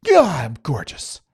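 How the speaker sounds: slow, smug self-admiring line; lightly voiced smug preening; John Lithgow vanity. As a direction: purr it at the mirror like a vain sitcom alien. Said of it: "John Lithgow used to say that".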